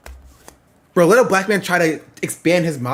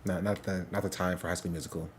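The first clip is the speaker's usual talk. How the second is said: dry muttered aside; 10 semitones lower, 15 dB quieter than usual. Real, not acted. Reacting to intro clip.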